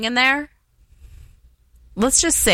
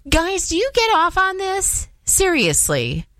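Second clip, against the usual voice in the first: higher, incredulous jab at the guys; exasperated; 9 semitones higher than usual. Real, not acted. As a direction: demand an answer in disbelief. Venting at men who ghost.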